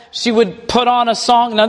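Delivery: ordinary delivery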